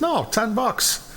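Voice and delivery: high, drawn-out owner, lightly voiced; dog's owner. Owner corrects the buyer's price, lightly voiced, slow and high. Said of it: "He says".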